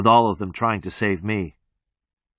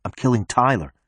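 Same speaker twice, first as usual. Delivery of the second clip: ordinary delivery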